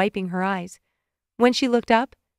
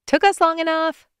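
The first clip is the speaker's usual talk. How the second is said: laughing; 9 semitones higher than usual. A narrator's reading. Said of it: "Amanda laughed".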